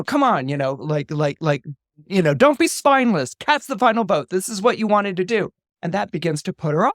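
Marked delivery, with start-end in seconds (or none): 0.0-0.5 aggressive. Retold or imitated. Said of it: "they get very aggressive". 2.3-5.5 higher, louder, pushy demand; bully her into voting; aggressive. Retold or imitated. Kendall pressures sister like their dad, aggressive. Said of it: "they get very aggressive, particularly Kendall".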